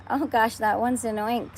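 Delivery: high, loud panicked scream. A narrator's reading. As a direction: shriek it out in alarm. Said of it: "screamed the head of the fire department".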